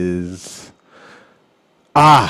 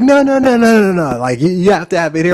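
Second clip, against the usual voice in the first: much higher, louder no no no; insistent auntie; 8 semitones higher, 4 dB louder than usual. Retold or imitated. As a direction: insist like the auntie won't take no. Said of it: "But she's like".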